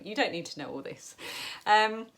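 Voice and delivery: normal talking voice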